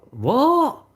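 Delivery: high, loud, startled what; a hammed-up confused yelp; mock bafflement. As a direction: squawk it out like you can't believe it. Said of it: "I'm a bit like".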